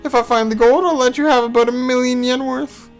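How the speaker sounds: high, bouncy and cheeky; perky high voice. Acted. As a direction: lift the voice high and playful. Erica voiced, bright, higher.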